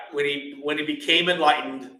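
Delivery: usual speaking voice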